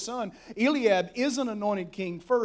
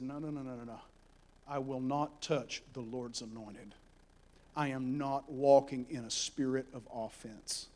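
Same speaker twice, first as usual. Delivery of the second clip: quiet firm refusal; 9 semitones lower, 10 dB quieter than usual. Acted. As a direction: hold back gently but firmly. David refuses to kill Saul.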